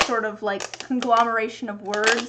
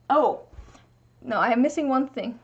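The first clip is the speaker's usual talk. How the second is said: normal talking voice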